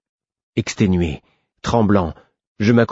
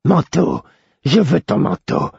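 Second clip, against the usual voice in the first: high, greedy cry of Manteau, slow at the end; 7 semitones higher, slower than usual. Acted. Hollow woman crying out for the hero's coat, greedy. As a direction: grab for the word like a starving thing. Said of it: "elle s'écria".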